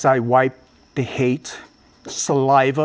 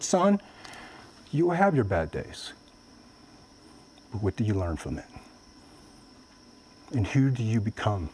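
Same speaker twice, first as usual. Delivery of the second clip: softer, lower voice for a comforting mother; gentle, quiet reassurance to her son; tender mother; 7 semitones lower, 9 dB quieter than usual. Retold or imitated. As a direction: hush it down like a mother at bedside.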